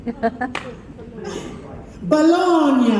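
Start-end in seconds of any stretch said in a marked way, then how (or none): 2.0-2.9 "Bologna" said the Italian way, bo-LON-ya with a ny sound; high, sung-out "Bologna" with the gn as ny; Italian pronunciation. Shown as a demonstration. Host quizzing fans on Italian, Italian pronunciation. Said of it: "How do you say".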